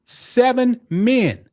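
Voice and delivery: seven men, each word drawn out slowly and hammered; slow emphatic repeat. Shown as a demonstration. Streamer repeats the news to his viewers, slow and emphatic. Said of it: "I'm gonna repeat that".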